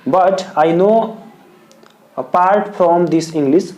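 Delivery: slow, word by word dictation; teacher dictating the model answer to students; dictation pace. Shown as a demonstration. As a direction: spell it out slowly for the notebook. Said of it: "तो answer लिखेंगे".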